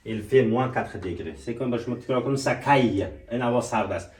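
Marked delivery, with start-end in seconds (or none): 0.1-1.4 slow, deliberate French model; slow model sentence. Shown as a demonstration. Teacher modelling the sentence slowly for repetition. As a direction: say it slowly so they repeat.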